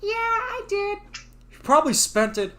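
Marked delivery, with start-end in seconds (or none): 0.0-1.0 put-on high sheepish boy voice; kid voice. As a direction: admit it like a guilty kid. Kid answers father.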